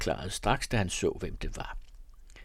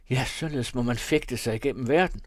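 ordinary delivery, nothing marked

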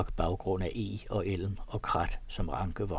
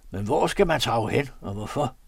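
louder offended grumble; grumbling, offended; 2 semitones higher, 6 dB louder than usual. A narrator's reading. The thief grumbles on about where to go, offended. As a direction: keep complaining, sulky. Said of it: "knurrende og fornærmet".

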